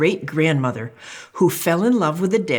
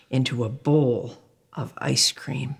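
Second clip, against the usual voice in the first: whisper; 4 semitones lower, 4 dB quieter than usual. Acted. Mom relishing ice cream.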